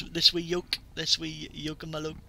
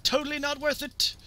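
high-pitched mock lament; playful high exclamation; high comic cry; 11 semitones higher, 4 dB louder than usual. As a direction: call it out high as you go down. Rueful shout while dying in game.

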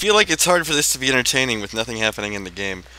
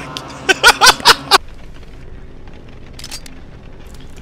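shrieking laugh, high and airy; high cackling laugh; 4 dB louder than usual. Real, not acted. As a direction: burst out cackling. Teammate died to chainsaw.

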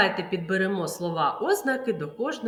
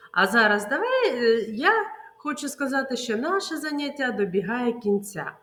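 normal talking voice

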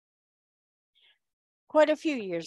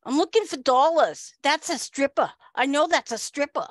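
put-on raised, emphatic New York accent; imitated Long Islander, higher and insistent; 4 semitones higher than usual. Woman digging for dollars, insistent Long Island accent. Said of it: "she's like".

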